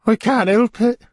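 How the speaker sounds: high, fluttery widow voice; fussy widow voice. A narrator's reading. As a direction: play the flustered widow, pitched high. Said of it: "she sighed again".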